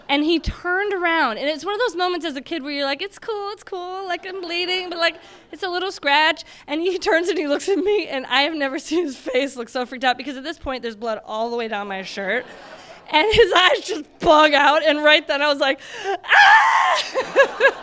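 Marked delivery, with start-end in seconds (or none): 3.0-6.4 high, breezy it's-fine voice; child downplaying a wound to dad; reassuring kid. Bleeding kid reassuring her dad. 16.3-17.8 put-on loud high panicked child scream; a child screaming at her father's shocked face. Kid sees dad's freaked face.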